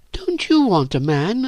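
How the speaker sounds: put-on high, pleading girl's question; tearful question. A narrator's reading. Virginia asks Phyllis with tears in her eyes, tearful. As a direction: ask it high, close to tears. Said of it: "tears in her eyes".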